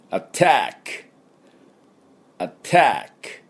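アタック said slowly and clearly for students to repeat; model attack for repeating. Shown as a demonstration. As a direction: model アタック, stress on the second syllable. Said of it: "私の後について発音どうぞ".

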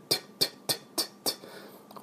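トゥ as a breathy puff with no voice; whispered t drill. Shown as a demonstration. Teacher drills the t sound for students, whispered puffs. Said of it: "Tの音もしっかりね".

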